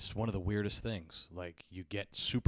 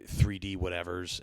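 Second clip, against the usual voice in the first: ordinary delivery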